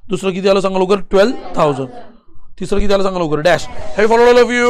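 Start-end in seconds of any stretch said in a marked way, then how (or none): 4.0-4.7 high, sing-out check-in to the class; calling out. Real, not acted. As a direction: call it out high to the whole room. (उंच आवाजात वर्गाला हाक)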